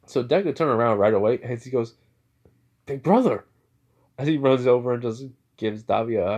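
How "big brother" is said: higher, louder happy call; excited greeting. Acted. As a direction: call out to a beloved big brother. Greeting brother he runs to hug.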